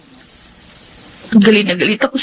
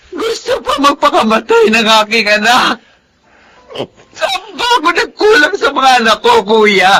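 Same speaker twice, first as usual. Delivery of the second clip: tearful, shouting sobs of despair; crying despair; 3 semitones higher than usual. Acted. Mother tells her brother about her daughter's suicide attempt, crying. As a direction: wail out her failure as a mother.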